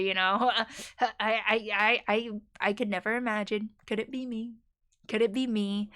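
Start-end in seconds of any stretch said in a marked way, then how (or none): 3.9-4.5 soft, small mock-wondering voice; hushed playful wondering. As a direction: ask it softly, as if to yourself. Repeats mock-wondering question two ways.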